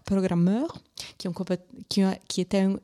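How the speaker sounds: ordinary talk, nothing marked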